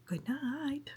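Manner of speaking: soft whispered good night. Real, not acted. Vlogger signing off to viewers, whispering. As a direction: breathe it out gently.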